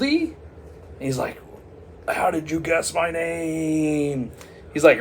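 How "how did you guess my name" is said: high, drawn-out mock wail; hammed-up villain crying out in dismay, slowly; thwarted villain wail. Curser whose new name was guessed, wailing in dismay.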